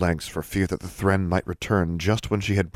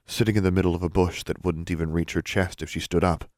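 usual speaking voice

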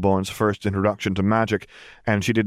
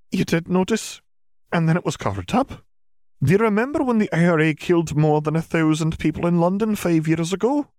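higher-pitched witch making her point; McGonagall, insistent; 10 semitones higher than usual. A narrator's reading. Witch correcting doubting parents, insistent higher voice. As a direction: press the point in a raised, formal voice.

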